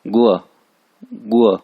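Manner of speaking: गुआ punched with a falling tone; forceful, falling गुआ; fourth tone. Shown as a demonstration. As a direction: drop the pitch sharply through गुआ. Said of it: "क्योंकि four टू ना".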